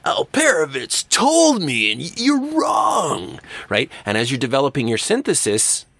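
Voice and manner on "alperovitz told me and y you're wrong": high, drawn-out exaggerated contradiction; building to a hammed-up shout on the last words; mock indignant rebuttal. Mock argument with another writer.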